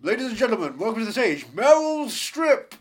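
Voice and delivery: loud, big announcer voice, name stretched high; mock stage announcer. Host welcomes Meryl Streep to the stage, mock announcer.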